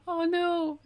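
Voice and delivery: sudden gasp, high and loud; startled gasp. Real, not acted. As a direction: caught off guard, gasping in alarm. Stabs herself with felting needle.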